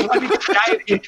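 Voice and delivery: repeating it through a laugh. Retold or imitated. Teller mocking his own eagerness to Jimmy, laughing. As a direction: laugh the line out as the joke lands.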